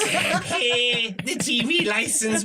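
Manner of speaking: bad Armagh accent. The wedding woman pleading with Brian to pay the licence, in a drawn-out Armagh accent. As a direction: stretch the words right out in a hammed-up Armagh accent. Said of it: "this is a bad Armagh accent".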